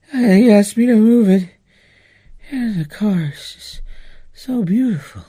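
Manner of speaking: high, airy voice of wonder; swooning over a gorgeous car, voice lifted; dreamy, lifted awe at the beautiful Jag; awestruck. Retold or imitated. Telling kid about the Jag, awestruck.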